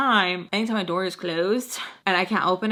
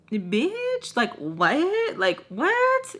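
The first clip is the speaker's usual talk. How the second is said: incredulous; 8 semitones higher, much slower than usual. Retold or imitated. Sister's incredulous look voiced.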